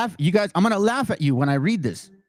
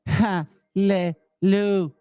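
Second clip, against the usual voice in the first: slow, drawn-out mock chant of hallelujah; much slower than usual. Mocking the recorded reader, drawn-out sarcastic hallelujah. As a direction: stretch out each hallelujah in exaggerated, slow mockery.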